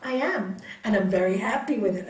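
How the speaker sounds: ordinary talk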